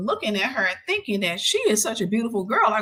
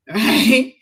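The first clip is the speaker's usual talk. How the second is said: ordinary talk, nothing marked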